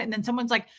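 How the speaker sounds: ordinary talk, nothing marked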